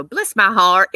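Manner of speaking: mock-loud self-pitying outburst; imitated coworker's exclamation. Coworker mocking her own silly mistake, imitated loud exclamation. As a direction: blurt it out loudly, imitating her. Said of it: "She'd go".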